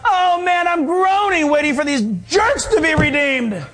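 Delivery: high, hammed-up groaning complaint; exasperated mock death moan; dying deer. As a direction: wail it like a comically dying animal. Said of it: "he's dying on the side of the road".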